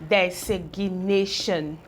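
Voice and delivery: "designation" drawn out slowly, syllable by syllable; slow blending. Shown as a demonstration. Teacher to pupils, blending the syllables slowly. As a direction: stretch "designation" out as you blend it. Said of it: "So I have put together".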